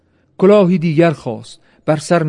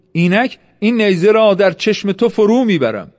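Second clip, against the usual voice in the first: higher-pitched narrated threat; bold, pitched-up challenge; threatening; 6 semitones higher than usual. A narrator's reading. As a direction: warn your rival with raised voice. Warrior threatens rival in quarrel.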